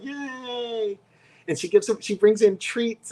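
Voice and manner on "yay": high drawn-out "yay" back; retold high little celebration; happy cheer. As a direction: echo the coworker's squealed cheer. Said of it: "she was, like, so happy".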